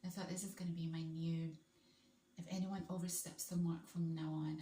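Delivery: normal talking voice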